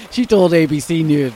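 ordinary talk, nothing marked

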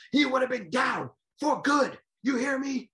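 high, loud boast with a hammed-up voice; boastful McCall. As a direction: crow about the win like the winner. McCall post-fight interview.

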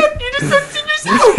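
slow, very high falsetto; failed high-note impression. Mocked for missing the high notes, falsetto impersonation. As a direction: squeak it out way above your range. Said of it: "can't hit those high notes".

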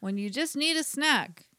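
punchy, playful sponsor spot; mock ad read. Podcast host plugging peanuts as a sponsor, mock ad read. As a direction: sell the snack with emphatic delivery.

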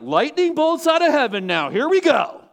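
loud and high, a gleeful call for lightning; mock vengeful shout. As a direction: shout as if ordering the strike yourself. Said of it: "we'd be like".

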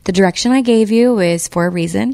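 ordinary talk

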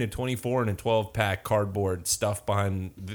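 usual speaking voice

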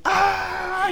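high mock yelp of panic; mock scream. Retelling the Anchorman fight scene, high mock scream. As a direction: squeal it like a startled anchorman. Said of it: "He's like".

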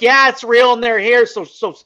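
loud, high urgent insistence; forceful retold order. Camper telling crying friend to lock it up, loud and urgent. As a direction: push through his panic and take charge.

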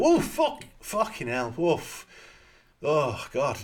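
high, loud shocked yelp; startled shock. Retold or imitated. Someone shot right beside you, shocked loud swearing. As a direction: jump out of your skin, swearing. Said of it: "you'd still kinda go".

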